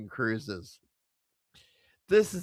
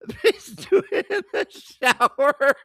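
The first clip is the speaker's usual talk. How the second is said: laughing; 9 semitones higher, 5 dB louder than usual. Real, not acted. Laughing while mimicking the announcement.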